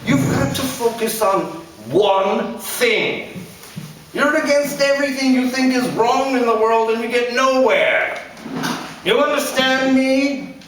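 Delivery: a blustering father scolding his son; angry father. A narrator's reading. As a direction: lecture him like a bitter dad.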